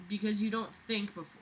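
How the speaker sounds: normal talking voice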